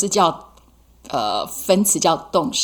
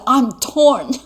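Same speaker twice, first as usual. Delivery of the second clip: example line; 6 semitones higher, 5 dB louder than usual. Shown as a demonstration. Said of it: "You can say".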